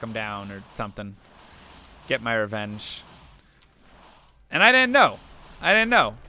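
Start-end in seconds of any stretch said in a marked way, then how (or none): 4.5-6.2 emphatic outburst. Retold or imitated. Reliving anger after a foul.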